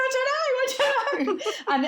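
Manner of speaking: laugh-cry in confusion; panicked laughing. Retold or imitated. Answering her husband as the twins move, laughing in panic. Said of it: "I went".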